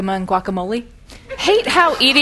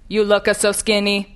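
stiff robotic Italian grandma; a hint of a robot nonna coaxing food. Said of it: "in robot voice".

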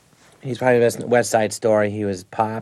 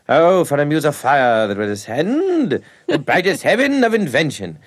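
slow, grand, higher recitation with a laugh breaking in; hammy Shakespeare professor; 4 semitones higher, 4 dB louder than usual. Retold or imitated. Professor reciting Henry the Fifth in a hammy declaiming voice. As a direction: declaim it like a pompous old actor. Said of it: "Like that".

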